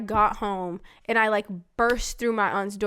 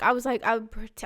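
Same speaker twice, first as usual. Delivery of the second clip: ordinary delivery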